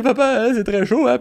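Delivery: put-on high, amused little boy voice; child's voice. Son repeating to his dad that it's very hot, high childlike. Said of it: "Parce qu'il dit".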